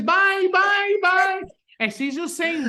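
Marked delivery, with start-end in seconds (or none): 0.0-1.4 high, loud squeaky repeated byes; mock scared byes. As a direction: squeal the byes running downstairs. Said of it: "cam six coming down the stairs".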